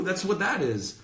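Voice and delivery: ordinary talk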